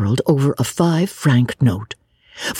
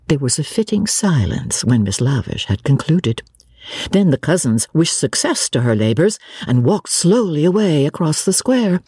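ordinary delivery, nothing marked

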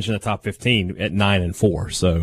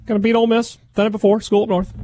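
much higher bragging coach voice; coach impression; 13 semitones higher than usual. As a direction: play a cocky coach boasting.

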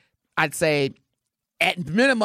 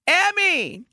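usual speaking voice

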